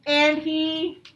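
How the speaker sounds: slow, clear storybook reading; patient read-aloud to young pupils; reading to children. A narrator's reading. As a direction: read it out slowly for the class.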